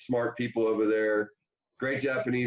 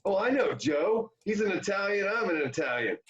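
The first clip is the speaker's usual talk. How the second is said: put-on higher, eager old manager's sales pitch; Tommy Lasorda impression; 9 semitones higher than usual. Lasorda cold-calling Kevin about Japan money, high hearty pitch. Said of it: "And he goes".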